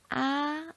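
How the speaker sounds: "아" held high and level; first Mandarin tone. Shown as a demonstration. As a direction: sustain "아" on a high, even note. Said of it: "사성이 있다고 그러죠".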